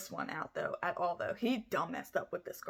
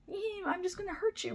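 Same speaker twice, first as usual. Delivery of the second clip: much higher, sing-song mock voice; mock Joseph; 11 semitones higher, 3 dB quieter than usual. Narrator mocks Joseph's warning shots in a high sing-song voice. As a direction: play the killer as falsely sweet.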